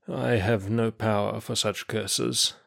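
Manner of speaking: weak, labored speech. Acted. Weak prisoner answers his accuser slowly and with difficulty. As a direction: push the words out like a sick, exhausted man. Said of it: "speaking slowly and with difficulty".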